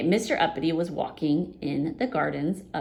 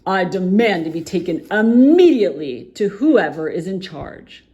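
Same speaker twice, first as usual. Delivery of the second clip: loud furious command; Uppity demands to see the ruler, loud; angry demand; 3 semitones higher, 10 dB louder than usual. Acted. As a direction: demand it imperiously. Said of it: "said Mister Uppity angrily".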